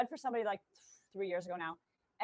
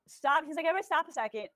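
mildly raised, imitated preacher's call; calling a halt; 5 semitones higher, 4 dB louder, faster than usual. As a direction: lightly act out halting a rowdy congregation. Preacher halting a laughing congregation.

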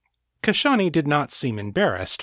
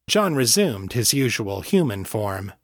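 ordinary delivery, nothing marked

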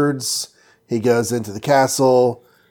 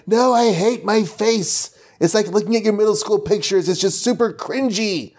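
pitched-up whining villain; high whiny witch; 9 semitones higher than usual. Retold or imitated. Podcaster voicing Umar seeing her old face, high hammed-up whine. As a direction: wail it high like a vain witch.

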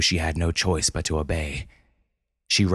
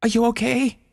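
high anxious calling; alarmed high voice; worried cry. Acted. Brenda finds wounded penguin.

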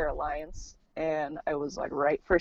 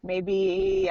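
usual speaking voice

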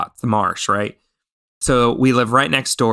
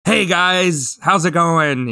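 higher-pitched, breezy greeting to strangers; acted guy-to-guy hello, pitched up; casual hail; 6 semitones higher, slower than usual. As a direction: call a chummy hello out the window. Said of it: "so I just kinda roll down the windows and I'm like".

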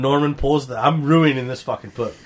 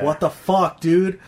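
higher-pitched exasperated protest; getting mad; 5 semitones higher, 4 dB louder, slower than usual. Retold or imitated. Reader fed up with bad writing, getting mad. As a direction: let the annoyance lift the voice. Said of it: "I'm getting mad reading this".

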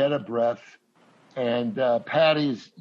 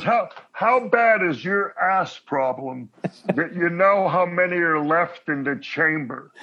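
Ray Romano impression; 4 semitones higher, 3 dB louder than usual. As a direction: ask it like Ray in disbelief. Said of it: "He goes".

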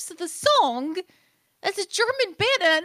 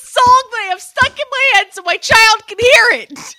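loud, high begging at a machine; hammed-up desperate shout; exasperated plea; 6 semitones higher, 10 dB louder than usual. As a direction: plead loudly, at the end of your rope. Mock pleading with the device.

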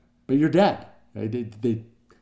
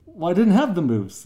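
lightly voiced British protest, pitched up; high, louder objection from a British guest; mock-British indignant dinner guest; 8 semitones higher, 6 dB louder than usual. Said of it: "she's very British".

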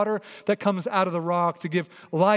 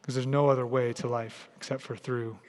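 soft, low, unhurried prayer voice; quiet closing prayer; 5 semitones lower, 4 dB quieter than usual. Acted. Pastor praying over the congregation, soft and low. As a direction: pray gently over the room, lower and softer.

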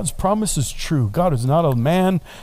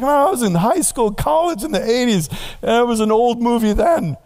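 mock old-timer; 8 semitones higher than usual. Preacher mocking his own age to the friend, high whine. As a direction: whine like an old man remembering.

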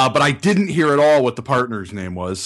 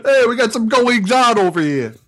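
brash New York reporter voice, raised pitch; Long Island accent; 8 semitones higher than usual. Pretend. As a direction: call it out like a Long Island local. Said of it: "that Long Island accent".

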